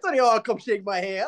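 normal talking voice

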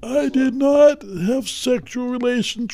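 high-pitched presidential impression; Bill Clinton. Guest showing the hosts his best impersonation, presidential voice. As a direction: imitate a president's famous denial. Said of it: "Yes, Bill Clinton".

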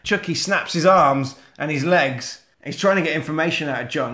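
ordinary delivery

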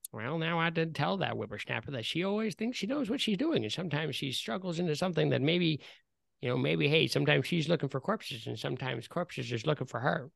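put-on quiet muttering old man voice; old man mumbling. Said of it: "you hear this old man mutter".

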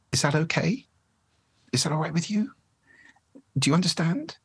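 every phrase ending on a rising question tune; uptalk demo. Shown as a demonstration. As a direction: end each line going up like a question. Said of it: "end every statement as if it were a question".

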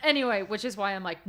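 usual speaking voice

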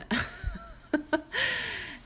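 a burst of high, breathy giggling; high giggle. Real, not acted. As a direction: giggle at yourself, high and light. Laughing at her own [name] story.

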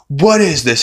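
retold indignant demand; mock outrage. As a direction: imitate someone disgusted by a drink. Tom spits out the coffee.

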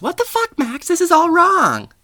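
high, loud mock shriek; outraged classmate. Becky scolds Max over the botched peer review, shrieking in outrage. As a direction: shriek it in exasperation, stretching the end.

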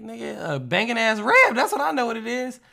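a high, loud boast about the rib; high and loud, proud of the meal; cocky insistence. Retold or imitated. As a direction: brag it up, sure of yourself. Insisting it's a great rib.

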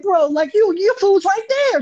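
high, loud, slow outburst; exasperated high yell. Retold or imitated. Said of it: "I'm like".

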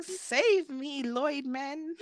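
high-pitched mock cry for rescue; playful damsel in distress. Girl playing princess calls for rescue, playful damsel. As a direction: call to your hero like a pretend princess.

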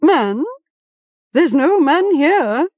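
high, slow squawk, lying; a bird hastily denying anything; evasive raven. A narrator's reading. Nervous raven denying Gom's question.

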